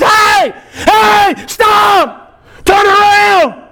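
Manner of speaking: high, hard cries of warning; urgent pulpit shout. Acted. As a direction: call out to halt sinners in danger. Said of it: "the preacher stands at the pulpit and says".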